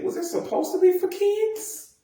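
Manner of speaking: very high, squeaky disbelief; incredulous. Acted. Vlogger baffled that a bloody show aired for kids, incredulous. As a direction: question it in a high mock-baffled voice.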